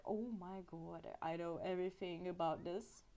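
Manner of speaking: low, hushed aside of a private thought; inner thought. Retold or imitated. Tourist silently reacting to a guide in their mind, hushed inner thought. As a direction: murmur it as if only thinking it. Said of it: "in my mind I'm like".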